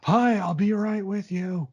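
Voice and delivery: high-pitched imitated call-out; retold yell. Customer service exchange retold at the store, mock yell. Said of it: "then he just yells out".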